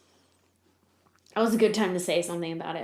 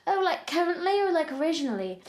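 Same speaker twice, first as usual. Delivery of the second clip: higher-pitched fake British; put-on British accent; 8 semitones higher than usual. Answering the band's question, put-on British accent. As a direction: answer lightly as a pretend Brit. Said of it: "I put on a British accent".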